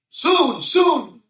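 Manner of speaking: put-on very high, strained old rabbi voice, slow; old rabbi's blessing. Rabbi blessing the girl who has no lines, in a high old voice. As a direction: croak out a blessing like an elderly rabbi.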